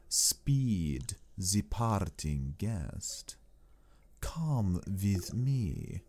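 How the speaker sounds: put-on slow, stately accented Count with sweeping pitch; courtly Count Dracula. Count bidding his young guest farewell, slow and courtly. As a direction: draw out every word as a gracious, sinister host.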